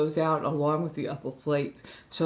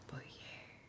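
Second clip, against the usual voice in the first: whispering; 23 dB quieter than usual. Real, not acted. Trailing aside.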